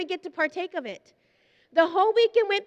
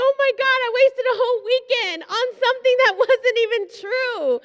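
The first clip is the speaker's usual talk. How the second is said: much higher and louder, half laughing, half crying; laughing-crying exclamation; 7 semitones higher, 6 dB louder than usual. Real, not acted. Preacher recalling her wasted weekend, laughing almost to tears. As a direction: wail it through tears of laughter.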